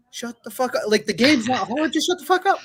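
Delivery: high, exasperated inner rant; mock fed-up snap at the squad; frustrated at the talkers, voiced high.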